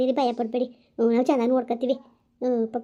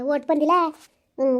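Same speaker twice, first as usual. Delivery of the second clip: normal talking voice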